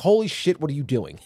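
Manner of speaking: high, loud burst of parental alarm; retold outburst, starting high and loud; mock scolding. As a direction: scold the kids, acting it out. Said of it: "when the kids get, like, disciplined".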